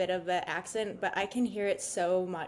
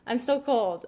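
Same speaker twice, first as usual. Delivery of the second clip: cold squeaked up high, whining; whiny complaining; 5 semitones higher, 4 dB louder than usual. Retold or imitated. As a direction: playfully whine about the cold. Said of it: "I'm like".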